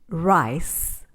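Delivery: ordinary talk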